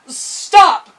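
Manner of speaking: high, loud frightened scream. Acted. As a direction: scream at the giant. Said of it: "screamed the girl".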